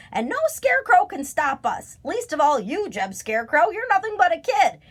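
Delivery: high, loud cawing taunt; Grandfather Crow. A narrator's reading. Crow taunting the young scarecrow, high loud caw. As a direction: jeer like a crow. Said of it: "cawed Grandfather Crow".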